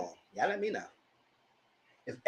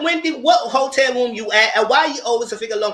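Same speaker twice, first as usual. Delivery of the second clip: louder, higher call out; calling to Wendy; 8 semitones higher, 6 dB louder than usual. Retold or imitated. Calling out to Wendy alone in her hotel, louder and higher.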